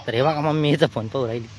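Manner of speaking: ordinary delivery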